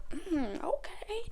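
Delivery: soft hum, then a high questioning okay; hushed mock prayer murmur; mimicking murmured prayer. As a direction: imitate someone murmuring through a prayer. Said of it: "they're praying like this".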